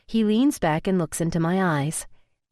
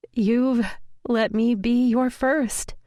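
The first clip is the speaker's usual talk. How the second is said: higher, slower, voice cracking with emotion; voice breaking; 5 semitones higher, much slower than usual. A narrator's reading. A woman confessing love, voice breaking. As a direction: let the voice break on first. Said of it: "My voice breaks on the last words".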